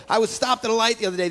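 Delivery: ordinary talk, nothing marked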